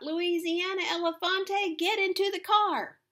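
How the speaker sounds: high, loud drawn-out holler; full-voiced, high-pitched summons; calling out. A narrator's reading. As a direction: holler an urgent order from a car. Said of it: "a voice called out".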